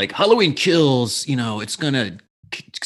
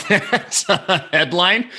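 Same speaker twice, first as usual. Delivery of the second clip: laughing; 8 semitones higher, 5 dB louder, slower than usual. Real, not acted. Laughing at confusing headline.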